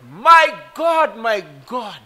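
high, loud cry breaking off; anguished cry. Acted. Preacher voicing Jesus crying out on the cross. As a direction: cry out to God in agony.

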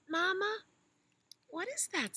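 high, eager little elephant; high child voice. Acted. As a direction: call out like a tiny calf.

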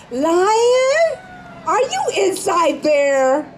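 a high call, slow after. Acted. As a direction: rabbit calls to the silent lion. Rabbit calls into the hut.

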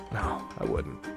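normal talking voice

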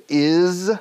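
"is" high and loud with a voiced z; emphatic demo of "is" ending in a buzz. Shown as a demonstration. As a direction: hum the final sound of "is" as z. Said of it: "una S zumbido".

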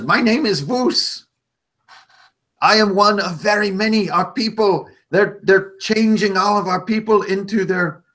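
high, urgent little goblin voice, slowing at the end; a small creature pleading for his people; goblin Vus. Acted.

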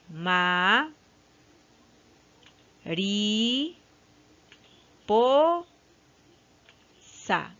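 "Ma, ri, po, sa" as four long, isolated syllables; long pause after each of "Ma, ri, po, sa"; split into syllables. Shown as a demonstration. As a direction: draw out each syllable of "Ma, ri, po, sa" for pupils. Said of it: "separamos en sílabas".

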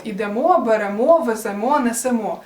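ідемо, беремо, веземо, несемо, each stressed on the final "о"; stress on last syllable. Shown as a demonstration. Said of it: "наголос на останній склад".

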